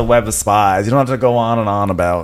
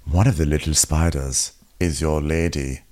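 lower, softer, slow menace; sinister reveal; 8 semitones lower, 6 dB quieter than usual. Retold or imitated. Larys revealing the spy to Alicent, low and slow. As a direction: drop it like a dark secret.

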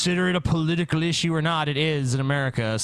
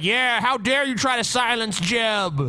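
mock outrage; 6 semitones higher, slower than usual. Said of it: "they'd be like".

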